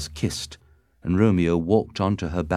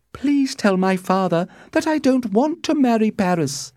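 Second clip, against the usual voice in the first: put-on high, insistent young heroine; high-pitched daughterly protest; Juliet, pleading daughter; 4 dB louder than usual. A narrator's reading.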